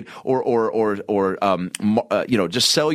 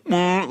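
imitated high hum; 7 semitones higher than usual. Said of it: "They go".

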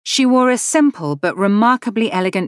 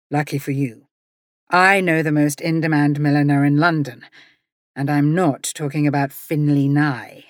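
lower, teasing gentleman's voice; smug gentleman; 3 semitones lower than usual. Acted. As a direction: boast about your milliner with a smirk. Jeremy voiced in a low voice.

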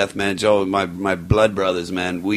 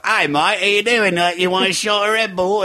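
higher, badly faked Australian accent; botched Australian accent; 9 semitones higher than usual. Drunk woman offering him a shot at the bar, botched Australian accent. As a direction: imitate her in a poor Australian-turned-English accent.

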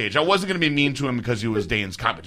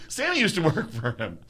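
usual speaking voice

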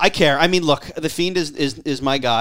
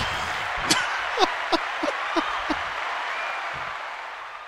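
breaking character giggling; 5 dB quieter than usual. Real, not acted. Laughing at his own fantasy booking.

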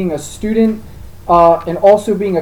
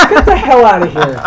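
laughing disbelief; 3 semitones higher, 6 dB louder than usual. Retold or imitated. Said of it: "I'm like".

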